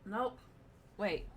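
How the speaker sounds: ordinary talk, nothing marked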